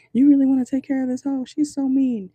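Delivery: high, loud imitation of a disapproving friend; mock incredulous protest. Friends objecting to his taking care of her, mock incredulous. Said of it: "People around you could be saying".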